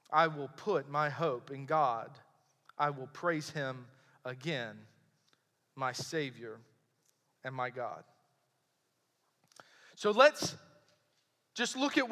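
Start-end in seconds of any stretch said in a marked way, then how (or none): 0.1-8.0 low, soft, fading psalm reading; subdued scripture reading. Acted. Preacher closes the psalm's hope in God, hushed and low. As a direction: let each phrase sink lower and softer, with pauses. Reads the psalm's last lines.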